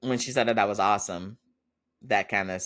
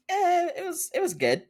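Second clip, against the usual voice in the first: higher-pitched, half-hearted "eh" quote; mock lukewarm answer; noncommittal. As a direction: imitate someone dodging details with a shrug. Acting a noncommittal answer about date.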